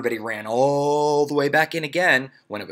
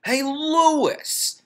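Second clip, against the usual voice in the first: put-on 1 shouting boy; 5 dB louder than usual. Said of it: "Damien shouted".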